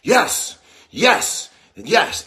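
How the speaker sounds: shouted high, loud triple yes; Russian gymnastics coach. Retold or imitated. Host imitating himself as a coach on the treadmill, yelling yes. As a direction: cheer it like a fierce gymnastics coach. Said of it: "like a Russian gymnastics coach".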